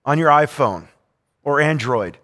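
normal talking voice

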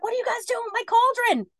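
put-on a nosy granny scolding kids; cranky old lady. Gracie May catches the boys at her cauldron, cranky old lady voice.

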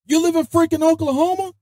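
very high, incredulous squeal; shocked disbelief. Retold or imitated. A sergeant realizes he is driving his soldier into Oklahoma, in a very high squeal of disbelief. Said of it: "I'm like".

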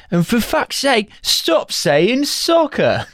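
high, slow, exasperated bad Cockney; mock Cockney shout. Hooligan scolding the American over football, mock Cockney exasperation. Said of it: "where he shouts at him".

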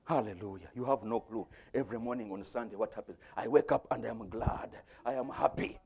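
ordinary delivery

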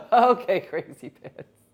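laughing through a retort; can barely get it out for laughing. Real, not acted. Woman retorting to her bossy best friend, laughing.

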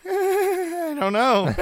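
put-on high, slow, drawn-out girl voice; mock clueless girl. Mimicking the ring girl to Mean Gene, high and slow. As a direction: play a clueless young lady, high and slow. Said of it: "And the one girl goes".

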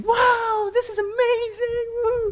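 hammed-up high excited voice; mock delighted wife. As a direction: squeal like a thrilled wife. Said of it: "I was expecting".

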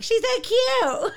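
high, loud, drawn-out gushing; Bethany. Retold or imitated. Co-host gushing over tiny Sue, imitated high squeal. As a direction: squeal it in mock adoration. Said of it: "who Bethany's like".

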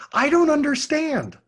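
put-on high, loud confused teen; mock-confused kid whining; whiny teen voice. Student quoted, confused.

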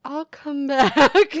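slow, high, loud awkward exit; awkward retreating customer. Retold or imitated. Customer retreating from crying clerk, awkward slow line. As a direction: back away embarrassed. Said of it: "what they were like".

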